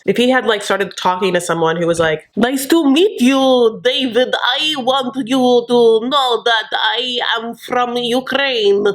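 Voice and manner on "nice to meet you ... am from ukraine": slow, higher mock Ukrainian accent; fake Ukrainian accent. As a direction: lay on a hammed-up Ukrainian accent, slowly. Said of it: "knows accents and knows voices".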